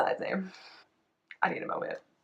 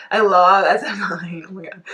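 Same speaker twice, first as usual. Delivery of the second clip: louder, slow, drawn-out declaration; gushing fan; 7 dB louder than usual. Real, not acted. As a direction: proclaim your love slowly.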